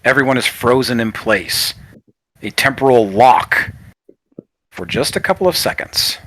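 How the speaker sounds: game master narration with long pauses; suspenseful scene narration. Acted. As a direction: let each halted moment hang in silence.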